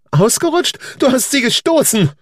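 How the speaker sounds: put-on the boy hurling the charge at his father; accusing child. A narrator's reading. Son accusing his father of pushing her, high and loud.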